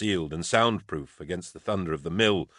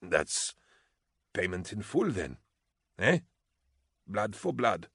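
bubbling dying whisper; death rattle; 6 dB quieter than usual. A narrator's reading. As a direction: speak through blood in the throat. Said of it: "his voice drowning and bubbling".

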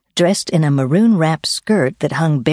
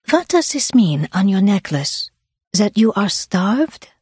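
put-on higher, curious Swiss-accented questioning; Susanna, Swiss accent; 5 semitones higher than usual. Swiss woman asking hiker about her necklace, Swiss accent. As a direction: ask curiously in a Swiss accent, slowing at the end. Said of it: "I am from Switzerland".

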